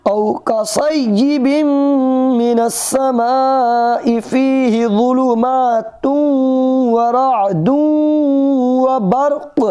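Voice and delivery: Quran recitation. Shown as a demonstration. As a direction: recite the verse slowly and melodically. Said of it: "इस तरीके से बोलेंगे, सुनिएगा".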